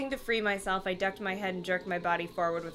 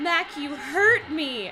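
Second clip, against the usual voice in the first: higher, louder drawn-out protest; cried out; 5 semitones higher, 5 dB louder, slower than usual. Acted. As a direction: cry it out, hammed-up and slow. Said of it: "I cried".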